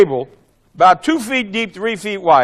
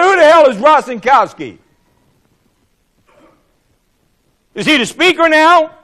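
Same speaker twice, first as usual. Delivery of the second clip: high, loud, indignant retort; indignant challenge; 8 semitones higher, 6 dB louder than usual. Retold or imitated. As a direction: throw the question back in indignation. Retold indignant challenge to colleague.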